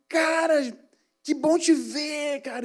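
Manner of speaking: high, slow, beaming hello; excited greeting. Retold or imitated. Claudinho thrilled to see him on the bus, excited. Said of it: "Com aquela empolgação".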